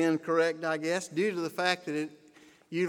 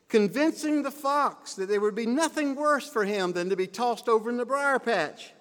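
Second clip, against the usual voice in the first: ordinary delivery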